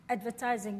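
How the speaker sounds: normal talking voice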